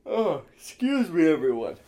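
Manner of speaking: high, loud mock outburst; sudden exasperated yell to the room; pissed-off yell. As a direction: act out losing your temper in public. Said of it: "like, yell".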